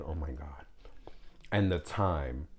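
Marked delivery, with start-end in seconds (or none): 0.0-0.7 whispered "oh my God"; whispered dismay at the cost. Real, not acted. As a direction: breathe it out in a whisper. Aside about cost of kids.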